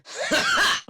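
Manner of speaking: a sharp, high burst of laughter; gleeful laugh. Acted. Squad fighter laughing after a kill, high gleeful burst. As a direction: cackle in triumph over the fallen.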